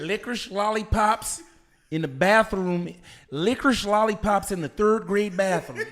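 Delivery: shouting amid laughter; loud laughing exclaim. Real, not acted. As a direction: belt it out incredulous.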